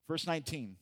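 ordinary talk

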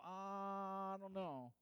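high, drawn-out doubtful "ah"; skeptical listener. Acted. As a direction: stretch out the hesitation, mock-doubtful. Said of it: "you're gonna go".

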